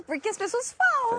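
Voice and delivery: high, swooping Brazilian protest; soaring intonation. Acted. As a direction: shoot the pitch up on key phrases. Replayed demo of soaring intonation.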